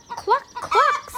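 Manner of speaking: high, bouncy cluck; hen clucking. Acted. Reading to kids, a high hen cluck. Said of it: "says the hen".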